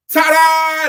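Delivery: high, very loud showman ta-da; ringmaster ta-da. Retold or imitated. Preacher acting out the acrobats for the congregation, big showy ta-da. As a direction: belt it out like a circus performer. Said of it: "sits down and goes".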